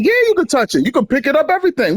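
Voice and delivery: high, chirpy mock reply; mock eager locals. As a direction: pitch it way up like a cheerful local. Imitating gun store locals.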